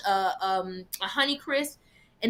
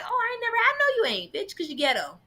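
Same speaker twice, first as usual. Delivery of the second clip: much higher, teasing sassy voice; sassy mock taunt; 6 semitones higher than usual. Teasing friends who never tried Malaysian food, high sassy taunt. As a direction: needle them like a playful diva. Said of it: "I'm like".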